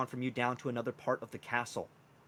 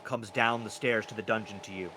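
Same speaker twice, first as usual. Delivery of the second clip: ordinary delivery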